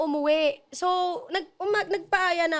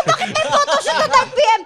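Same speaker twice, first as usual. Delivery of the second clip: exasperated; 10 semitones higher, 5 dB louder than usual. Retold or imitated. Retelling her own annoyed question.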